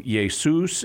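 "Jesus" pronounced yay-SOOS; Greek pronunciation. Shown as a demonstration. Apologist repeating the Greek name, Greek pronunciation. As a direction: Greek form of "Jesus", Y for J and long oo ending. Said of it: "got passed into Greek as".

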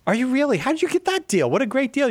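high, delighted disbelief; surprised. Real, not acted. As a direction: react with amazed excitement. Host surprised at double trip.

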